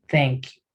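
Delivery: "Thank you" with TH as a Spanish Z, tongue at the upper teeth; soft th in thank. Shown as a demonstration. Teacher modelling "Thank you" with the tongue on the teeth. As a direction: start "Thank" with a lisped th, not a T. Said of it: "sonido de T H".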